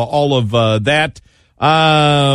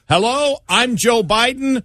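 high, sunny campaign ad voice; mock Biden ad intro, slow; feel good political ad; 9 semitones higher than usual. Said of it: "I'm thinking".

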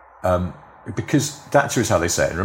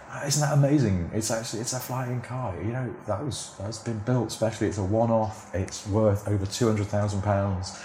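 lightly acted wonder, higher and softer; hushed, put-on awe; mock amazement; 4 semitones higher, 5 dB quieter than usual. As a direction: imitate a presenter marvelling at his gadget. Imitates presenter hyping flying car.

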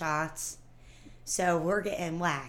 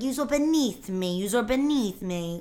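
slow, higher put-on Staten Island accent; mock mixed accent; 4 semitones higher than usual. Imitating the guy's shifting accent, slow and higher. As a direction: drag out beneath like a wannabe tough guy. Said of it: "his accents kept changing".

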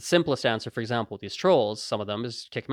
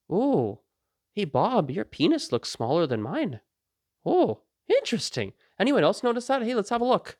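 high, swooping mock interest; feigned innocent curiosity; 8 semitones higher than usual. Wished-for comeback to naked Bob, mock-curious. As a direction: act openly intrigued, inviting others to join.

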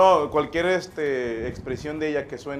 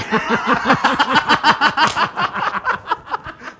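loud, high-pitched burst of laughter; 13 semitones higher, 9 dB louder than usual. Real, not acted. Cracking up at his joke about his wife, a high loud laugh. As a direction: crack up hard.

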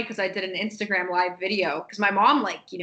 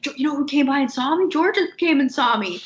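put-on retelling an excited old man on the phone, higher and louder; bubbling over with news; imitating his delighted phone call; 7 semitones higher, 5 dB louder than usual. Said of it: "he was really excited".

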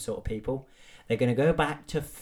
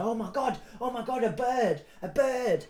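very high squealing oh my God, a bird; mock-thrilled high squeal at a bird; mock excitement; 12 semitones higher than usual. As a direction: squeal like you've never seen a bird. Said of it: "I was like".

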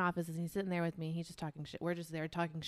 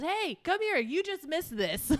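much higher and louder, calling someone over; 9 semitones higher, 6 dB louder, slower than usual. Retold or imitated. Staff member calling a coworker across the gym, loud call. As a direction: shout it across the room.

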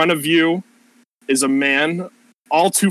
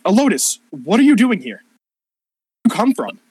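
higher, louder pressing questions; Persephone questioning; 8 semitones higher, 4 dB louder than usual. Acted. Persephone pressing the player for answers, higher and louder. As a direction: demand answers.

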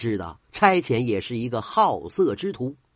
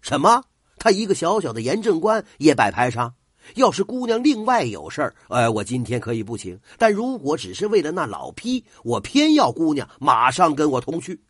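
furious official shouting, louder and higher; angry; 4 semitones higher, 5 dB louder than usual. Acted. Official raging at the old musician, loud angry shout. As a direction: bark the protest like an outraged superior. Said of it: "差遣发火了".

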